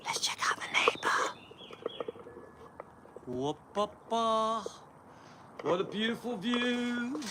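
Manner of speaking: sneaky whisper. Pretend. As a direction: hush it like spying on neighbours. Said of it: "let's be sneaky".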